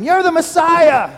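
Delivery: loud jeering taunt; mocking shout. Criminal on the cross taunting Jesus, mocking shout. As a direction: yell it up at him in scorn. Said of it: "began to shout and make fun of him".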